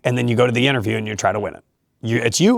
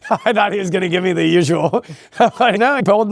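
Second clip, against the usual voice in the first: laughing while talking; 7 semitones higher than usual. Real, not acted. Coach still chuckling while recalling Doc's honest verdict. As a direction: talk through the laugh, higher.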